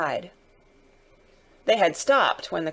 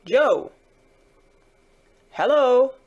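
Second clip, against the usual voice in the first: raised, louder hail; hailing call between coachman and guard; 6 semitones higher, 6 dB louder, slower than usual. Acted. Coachman hails guard, guard answers.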